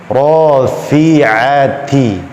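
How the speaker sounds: RAFI'ATI with light "æ" after 'ain; correct thin ain. Shown as a demonstration. Teacher giving the right reading, thin 'a in Rafi'ati. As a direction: chant Rafi'ati slowly, thick ra, thin 'a. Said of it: "Tidak boleh dibaca".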